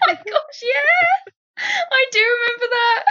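high squealing laugh through words; laughing hard. Real, not acted. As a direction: laugh while you say it. Laughing at embarrassing memory.

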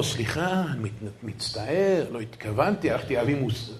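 soft, low, faltering apology; quiet stammered excuse, voice sinking; shamed apology. Retold or imitated. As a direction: imitate a humiliated man apologizing meekly. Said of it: "מסכן, האברך הזה הלבין".

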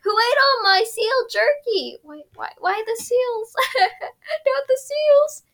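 high, slow wailing mock despair; whiny Sokka. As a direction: wail over the lost jerky. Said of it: "he's like".

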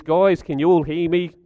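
usual speaking voice

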